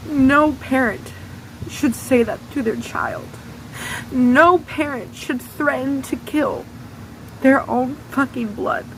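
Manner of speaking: real upset while retelling, pitch lifted and tight; voice breaking. Real, not acted. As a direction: push through the words as the memory hurts. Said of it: "It's really hard for me to talk about".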